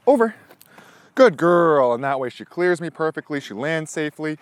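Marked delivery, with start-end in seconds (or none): 0.1-0.4 high, loud command to the dog; sharp jump cue. Real, not acted. 1.1-1.9 higher, much louder praise; excited praise. Real, not acted. Praising dog after jump.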